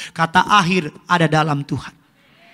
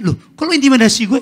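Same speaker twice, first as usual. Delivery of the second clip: mock surprise; 8 semitones higher, 6 dB louder, faster than usual. Devil voiced surprised.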